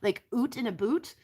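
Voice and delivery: playful mock-Canadian "oot", rising like a question; hammed-up Canadian vowels, lilting upward; Canadian accent. Shown as a demonstration. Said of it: "with a Canadian accent".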